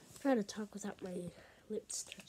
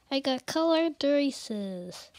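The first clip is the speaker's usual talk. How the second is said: excited; 3 semitones higher, 14 dB louder, slower than usual. Real, not acted. Repeats excitedly, much louder.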